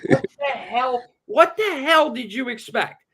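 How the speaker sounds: high, loud incredulous demand; worked-up ranting question; exasperated disbelief. Real, not acted. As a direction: demand an answer, voice shooting up. Exasperated rant at quoted father.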